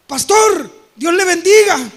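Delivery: loud, sheepish mock greeting with a laugh. Church member greeting.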